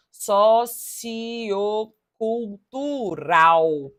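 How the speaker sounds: so-ci-o-cul-tu-ral spoken slowly, syllable by syllable; syllable split. Shown as a demonstration. Teacher giving the answer's syllable split, slow. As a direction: separate sociocultural into its syllables. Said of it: "a separação".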